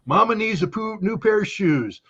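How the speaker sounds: hammed-up needy pleading; mock whiny demand. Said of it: "Ukrainians go".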